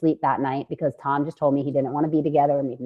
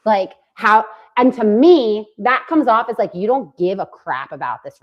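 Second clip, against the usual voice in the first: louder and higher, reliving the fight; upset, louder; 4 semitones higher, 6 dB louder than usual. Retold or imitated. Retelling the fight to her co-host, raised and upset. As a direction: heat rising as she tells it.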